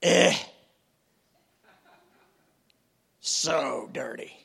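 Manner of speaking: mock disdainful master, reluctant help; disgusted Jesus. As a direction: sneer at the dirty sinner. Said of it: "do you imagine him going".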